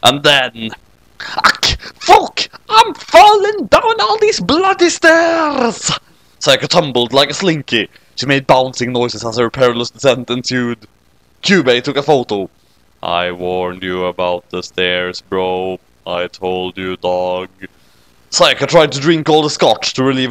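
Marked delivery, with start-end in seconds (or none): 1.2-5.9 much higher, slow, cartoon girl voice tumbling; high cartoon yelp of a girl bouncing down stairs; falling girl yelp. Character yelling while falling downstairs. 13.0-17.5 much lower, softer, slow creature drawl; a deep deadpan critter gloating; low smug creature saying I told you so; smug Kyubey voice. Acted.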